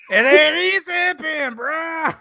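high, drawn-out puppet-style voice; sing-song high voice, stretched out slowly; Franklin. Acted. Answering a request for a voice, high Franklin impression. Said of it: "do a voice, I want Franklin".

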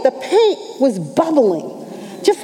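ordinary talk, nothing marked

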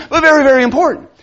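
high, loud, fast mocking very, very important; over-stressed very very important; mock emphasis. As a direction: sneer at the very, very.

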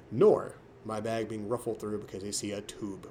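ordinary talk, nothing marked

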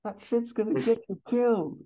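very high, incredulous echo; acted high, exasperated comeback; Axel Foley. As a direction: throw it back in a high-pitched squawk. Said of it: "Axel goes".

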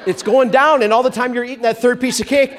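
ordinary delivery

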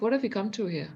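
usual speaking voice

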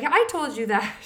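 high and loud, mock indignant; indignant retort. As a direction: insist you already told him, half laughing. Retelling her reply to husband.

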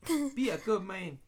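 mock Mandarin accent. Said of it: "Speak with a Mandarin accent".

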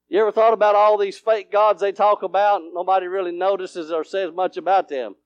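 ordinary delivery

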